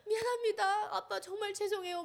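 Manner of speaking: high, pleading, tearful sorry; tearful apology. Retold or imitated. Daughter apologizing to her tortured father, tearful. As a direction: beg forgiveness through tears, voice high.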